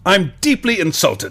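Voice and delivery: slow, wounded reading of the title; mock offended. Host reads the angry letter's title, slow and mock hurt. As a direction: read it like a huffy complaint. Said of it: "The title is".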